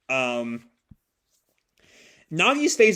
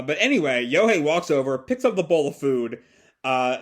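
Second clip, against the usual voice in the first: ordinary talk, nothing marked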